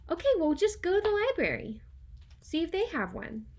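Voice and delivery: high, fast and loud, eager to help; excited advice. Pretend. Teacher tells pretend caller Fred to try the library, high and fast. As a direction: rattle it off high and bright with big energy.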